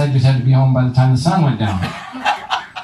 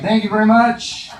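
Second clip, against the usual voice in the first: relieved dad; 7 semitones higher than usual. Pretend. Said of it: "I'd be like".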